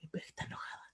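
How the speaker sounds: mock whisper of the suspicious listener; whispered quote. Imitating a listener who insists she's angry, whispered. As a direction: breathe it out like a suspicious aside.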